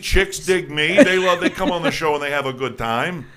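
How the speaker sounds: loud, high, teasing brag; playful boasting. Real, not acted. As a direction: show off with a grin. Host brags jokingly.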